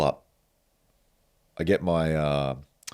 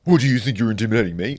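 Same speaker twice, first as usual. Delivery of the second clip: higher, louder, quick parroting; mocking echo; 6 semitones higher, 6 dB louder, faster than usual. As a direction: throw the question back, mimicking. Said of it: "And he's like".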